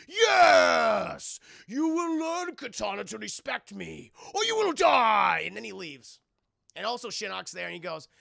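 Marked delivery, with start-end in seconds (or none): 0.1-5.4 booming, high, hammed-up tyrant rage; mock villain shout. Shao Kahn threatens his defiant daughter, hammed-up booming shout. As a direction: shout it grandly, stretching the threat.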